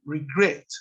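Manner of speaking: "Regret" with the accent on its last syllable, "gret"; stress on final syllable. Shown as a demonstration. Teacher showing that the accent falls at the end, final-syllable stress on "Regret". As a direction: put the weight on "gret" in "Regret". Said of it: "la acentuación está al final".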